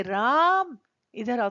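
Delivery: long calling राम. Shown as a demonstration. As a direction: call राम with a lengthened आ. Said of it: "पर जब हमें राम को पुकारना है".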